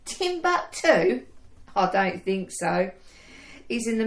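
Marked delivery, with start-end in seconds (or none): 0.1-1.2 high, slow disbelieving echo; incredulous. Acted. Audrey doubting Timbuktu is romantic, incredulous. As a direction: repeat it in disbelief. Echoing friend's joke incredulously.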